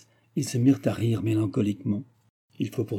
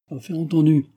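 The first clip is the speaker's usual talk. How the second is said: raised, pleased exclamation; exclaimed agreement; 5 semitones higher, 4 dB louder than usual. A narrator's reading. Brother clinches the agreement, mild exclamation. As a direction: seal the deal with a lift in pitch. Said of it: "s'exclama Germain".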